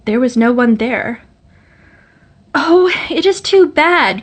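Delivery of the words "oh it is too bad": high, slow cry of dismay. A narrator's reading. Girl finds nobody at the door, crying out in dismay. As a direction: let the disappointment break out high and slow. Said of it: "cried Loris".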